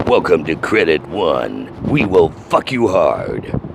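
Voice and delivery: low, slow sarcastic announcer; mock the bank's recorded welcome; mock bank greeting. Customer mocking the bank's phone greeting, slow, low and sarcastic.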